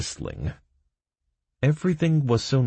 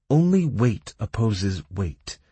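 solemn rule; 5 semitones higher, 4 dB louder, slower than usual. A narrator's reading. Kira states the rule.

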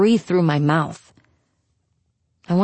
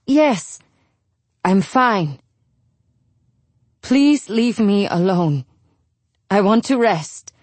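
tired mother; slower than usual. Acted. Mother voiced in story.

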